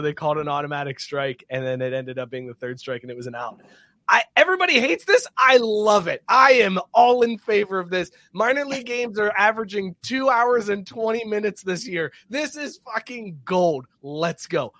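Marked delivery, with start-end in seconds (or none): 4.1-10.9 louder, much higher, fired up about the rule; excited rant. Real, not acted. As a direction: sell it like you truly love the new rule. Excited rant about the pitch clock.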